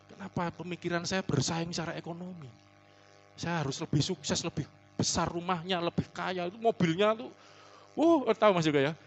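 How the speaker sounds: ordinary delivery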